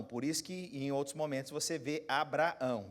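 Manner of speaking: usual speaking voice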